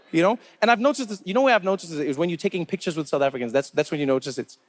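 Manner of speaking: ordinary talk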